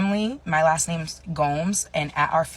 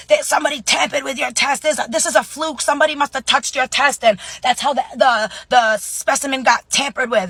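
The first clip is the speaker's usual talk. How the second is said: high, loud, agitated imitation; grandparents protesting; 10 semitones higher, 8 dB louder than usual. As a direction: imitate grandparents insisting at raised pitch. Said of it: "My grandparents are like".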